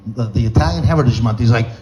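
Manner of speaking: ordinary talk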